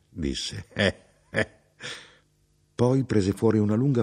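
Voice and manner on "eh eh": short, pleased "eh, eh" chuckle; dry little two-beat laugh. Acted. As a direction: chuckle to yourself in two small beats. Traveller chuckles over his bread.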